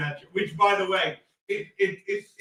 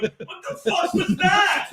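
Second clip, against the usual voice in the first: high, slow bewildered outburst; confused friend reacting to a call; shocked; 9 semitones higher, 4 dB louder, slower than usual. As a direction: stretch out the disbelief. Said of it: "I'm like".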